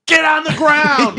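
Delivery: high, loud, slow bark of an order; mock cop-style shout; tough bystander. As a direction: yell it like a hammed-up vigilante. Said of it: "comes over and goes".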